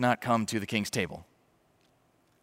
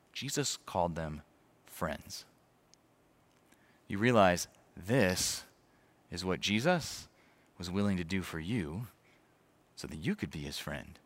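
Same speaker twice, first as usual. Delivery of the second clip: hushed sincere; 5 semitones lower, 7 dB quieter than usual. Real, not acted. Preacher's hushed closing point.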